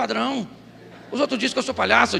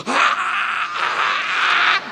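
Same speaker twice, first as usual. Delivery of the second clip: pentecostal preacher cry; 8 semitones higher than usual. Retold or imitated. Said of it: "muda a voz".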